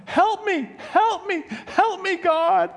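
high, frantic mock plea with a laugh in it; hammed-up panic calling for help; crying out to God. Pastor near the cliff cries to God in mock panic. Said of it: "starts to cry out to God".

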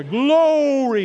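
Glory with the first syllable punched high and loud; stress on first syllable. Shown as a demonstration. Pastor demonstrating the anointed Pentecostal Glory, first syllable hit hard. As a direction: hammer the GLO of Glory like a revival preacher. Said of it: "You gotta emphasize that first, first syllable".

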